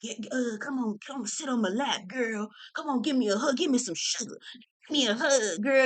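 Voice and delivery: soft, coaxing lightly voiced leer; quiet wheedling old-man voice, lightly put on; creepy old man. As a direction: beckon like the dirty old deacon at church. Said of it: "the nasty old man that you don't wanna talk to".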